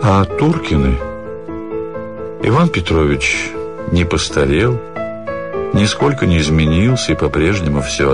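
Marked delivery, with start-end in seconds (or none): none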